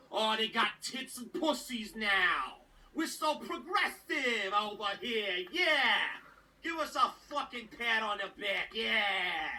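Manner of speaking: mock New Yorker sneer; bad New York accent. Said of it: "I chose a bad New York accent for that".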